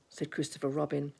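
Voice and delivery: ordinary delivery, nothing marked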